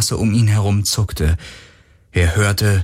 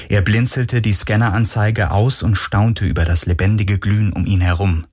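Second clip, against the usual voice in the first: ordinary delivery, nothing marked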